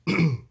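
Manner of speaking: loud whew. Real, not acted. Reacting to hot sauce heat.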